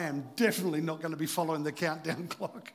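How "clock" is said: usual speaking voice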